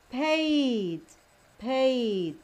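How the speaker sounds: paid with a stretched ay; long vowel sound. Shown as a demonstration. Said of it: "That is its sound".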